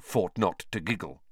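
ordinary delivery

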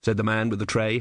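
ordinary delivery, nothing marked

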